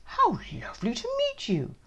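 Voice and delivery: high polite dad voice; sweet greeting. Acted. Dad greeting the new teacher sweetly. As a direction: charm the teacher.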